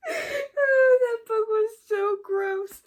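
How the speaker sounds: very high, loud, half-sung through laughter; laughing disgust. Real, not acted. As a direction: shriek it out while cracking up. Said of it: "still laughing".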